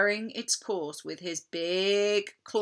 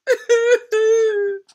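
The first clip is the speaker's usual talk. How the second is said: high, loud wail from the cupboard; a pirate bursting into loud sobs; sobbing wail; 15 dB louder than usual. A narrator's reading. Noise from the cupboard.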